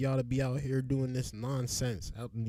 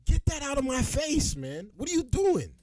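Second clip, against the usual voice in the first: playing the guy, shouting; 12 semitones higher than usual. Acting out the student yelling at protesters in his face, high shouting. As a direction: shove back at the protester with your voice. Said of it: "I was just demonstrating what I would have done".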